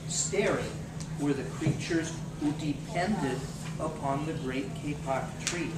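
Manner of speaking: ordinary delivery, nothing marked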